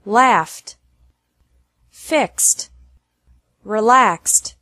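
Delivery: Laughed ending in an F-T sound; fixed and relaxed ending in X plus T. Shown as a demonstration. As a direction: say each ED as T, as in Laughed. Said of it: "E D is pronounced like T".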